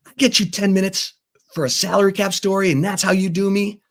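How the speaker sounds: high-pitched indignant complaint; angry imitation. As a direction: imitate an outraged man scolding a reporter. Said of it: "he was cussing me up one side".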